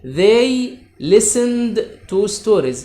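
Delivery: very slow, each word spaced out; slow dictation. Shown as a demonstration. Teacher reading an example sentence to pupils, very slow dictation. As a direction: dictate slowly to the class, word by word.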